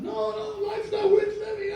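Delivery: very high, hammed-up misery; despairing wail. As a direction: wail like a man who's given up. Acts out Rick's despair.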